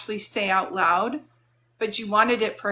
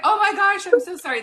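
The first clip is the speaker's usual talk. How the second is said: higher and louder mock gushing sorry; flustered apology; 10 semitones higher, 6 dB louder than usual. Medium voicing her moderator apologising, mock gushing. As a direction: play the flustered helper apologising, lightly.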